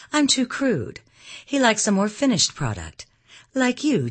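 high-pitched, pointed comeback; flashed retort. A narrator's reading. Young woman answering Anne about the Count, flashing back. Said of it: "as she flashed her answer back to Anne".

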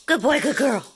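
loud squeaky dog praise; squeaky praising a dog. Real, not acted. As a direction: praise the dogs brightly. Praising the dogs.

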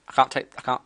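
ordinary delivery

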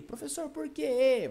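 high-pitched pupil voice; questioning student. Acted. Student asking teacher about the answer, high voice.

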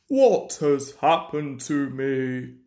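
low, puzzled engine voice; Gordon in dismay. A narrator's reading. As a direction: moan it low as a stricken engine. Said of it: "asked Gordon".

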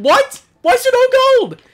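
high, loud shocked question; outraged disbelief. Real, not acted. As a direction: cry out in disbelief. Shocked at shop during crisis.

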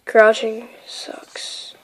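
slow unvoiced whisper. Real, not acted. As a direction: grumble it in a whisper. Whispering while crouched.

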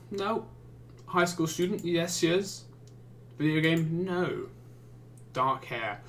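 normal talking voice

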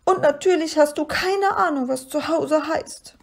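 high, tearful creature voice; schniefend. A narrator's reading. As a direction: reproach him through sniffles, pitched high. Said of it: "schniefte das bleiche Wesen". (hoch, weinerlich und vorwurfsvoll)

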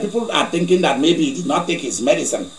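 usual speaking voice

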